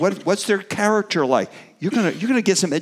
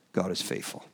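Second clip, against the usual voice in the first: near-whispered, fighting back tears; choked-up whisper; 9 semitones lower, 12 dB quieter than usual. Real, not acted. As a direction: breathe it softly, moved almost to tears. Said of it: "careful I don't start crying".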